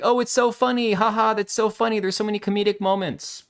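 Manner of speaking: high, sing-song mocking of fans. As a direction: parrot them in a silly high voice. Mocking fans who call it funny.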